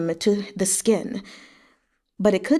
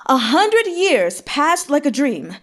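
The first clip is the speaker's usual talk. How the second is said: shouted; 5 semitones higher, slower than usual. A narrator's reading. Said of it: "shouted at the top of his lungs".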